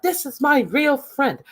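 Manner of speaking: high mocking impression of a bickering woman; hammed-up high-pitched mimicry; mocking quote. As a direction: squeak it out, mocking her. Repeated mock line.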